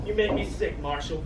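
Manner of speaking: ordinary talk, nothing marked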